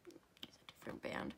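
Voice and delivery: a quiet whisper to herself; whispered aside. Real, not acted. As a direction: murmur it under your breath. Aside while working.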